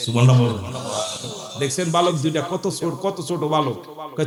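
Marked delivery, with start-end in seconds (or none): none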